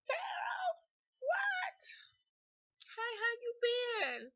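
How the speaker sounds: loud, very high squeal of recognition; re-enacted squealing, drawn-out greeting; excited surprise. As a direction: shriek a thrilled hello to a long-lost friend. Acting out joyful surprise reunion.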